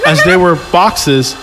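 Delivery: normal talking voice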